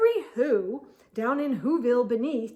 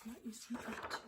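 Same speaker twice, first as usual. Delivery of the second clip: very soft wondering aside; 18 dB quieter, much faster than usual. Real, not acted. Aside to listeners.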